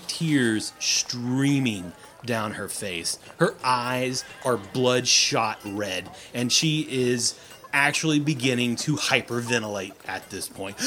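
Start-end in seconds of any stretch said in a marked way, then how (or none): none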